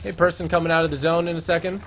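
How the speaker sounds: normal talking voice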